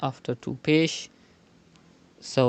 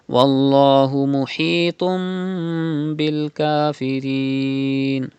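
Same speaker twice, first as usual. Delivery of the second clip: Quran recitation. Shown as a demonstration. Teacher recites the verse before explaining it, chanted.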